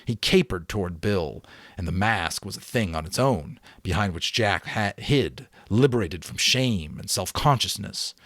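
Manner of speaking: usual speaking voice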